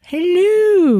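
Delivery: high, loud, swooping diva hello; breathy vintage purr. Pretend. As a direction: sing out like an old starlet.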